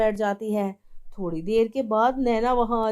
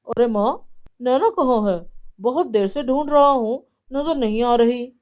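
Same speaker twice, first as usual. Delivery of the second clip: worried son; 6 dB louder than usual. Acted. Son calls for wife.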